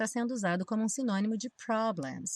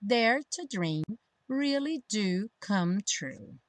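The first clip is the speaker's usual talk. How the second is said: slow, measured model phrase; pronunciation practice; 2 semitones higher, slower than usual. Shown as a demonstration. Teacher modelling lyrics for learners, slow pronunciation practice. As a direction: stretch the words out clearly. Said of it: "pra prática da pronúncia".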